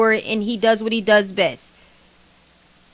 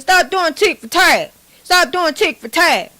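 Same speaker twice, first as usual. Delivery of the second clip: higher and louder mock of T K O's "Stop doing tit for tat"; 6 semitones higher, 4 dB louder than usual. Mocking TKO's scolding of his partner on the live, high, loud. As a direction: ham up the rival's scolding. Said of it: "You hear how he said".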